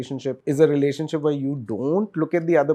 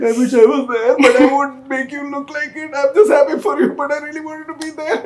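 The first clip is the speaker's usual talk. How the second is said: high, longing voice of a left-out friend; wistful envious friend; 13 semitones higher, 7 dB louder than usual. Acted. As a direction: sigh it out high and wishful. Envious friend on other side.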